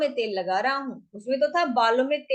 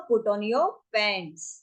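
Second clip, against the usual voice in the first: drawn-out teaching delivery; slow demo phrase; slow model phrase; 4 semitones lower, much slower than usual. Shown as a demonstration. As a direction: stretch it so learners can follow. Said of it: "how will you speak like this".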